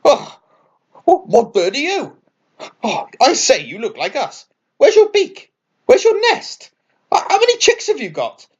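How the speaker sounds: a flock of birds all excited at once; excited squawking birds. Acted. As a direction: pile on the questions, squawking. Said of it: "bombarded by excited squawks".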